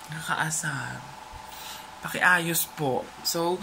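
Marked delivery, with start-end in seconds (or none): 0.1-1.1 annoyed whisper. Real, not acted. Annoyed aside about wifi.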